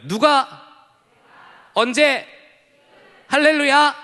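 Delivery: high, loud rally calls; shouted call and response. Retold or imitated. Leader shouting the closing cheer, loud and high. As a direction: belt each word out to the crowd. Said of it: "이걸 늘 했었어요".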